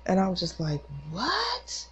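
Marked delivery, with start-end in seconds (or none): 1.1-1.9 very high rising "what"; stunned, squeaked-up reaction; retold shocked question. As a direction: pitch leaps up in disbelief. Started to lose it.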